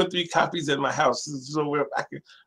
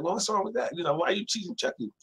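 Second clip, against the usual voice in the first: higher, gently scolding mom; mother's mild rebuke; 7 semitones higher, 4 dB quieter, faster than usual. Retold or imitated. Mother questions her son's teasing of Chucky, mildly scolding.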